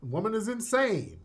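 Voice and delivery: lightly acted call-out of a yelling woman; mock holler. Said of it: "they had to holler at her like".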